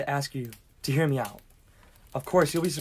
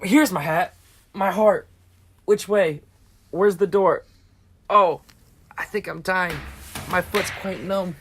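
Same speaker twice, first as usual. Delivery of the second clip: distressed, ailing; 4 semitones higher, 4 dB louder, slower than usual. Acted. Staggers out claiming he is dying.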